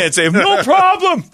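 high, loud callback yell; mock shout. Host repeating his catchphrase to cohost, high loud. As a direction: bark the catchphrase again.

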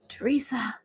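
calling her awake in a whisper; hushed wake-up call to the sleeping host; whispered name. Retold or imitated. Waking host in the night.

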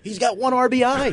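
high, loud ranting voice; Francesa rant impression. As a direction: rant like an outraged radio host.

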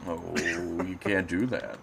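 ordinary delivery

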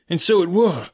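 high, loud eager agreement; spring up crying it out; excited cry. Acted. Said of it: "cried Morgan".

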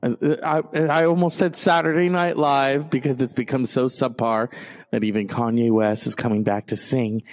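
ordinary talk

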